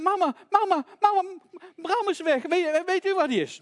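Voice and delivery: high, loud cries for mum; panicked child. Acted. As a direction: call out in alarm, acting a frantic child. Child runs in, lamb missing.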